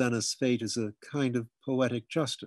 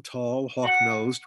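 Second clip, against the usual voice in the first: ordinary delivery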